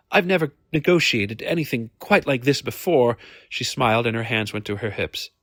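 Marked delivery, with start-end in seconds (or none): 0.1-3.2 lightly voiced higher lady; a hint of a ladylike client; earnest lady. Lady admits inexperience at negotiating to Hamish, earnest and higher.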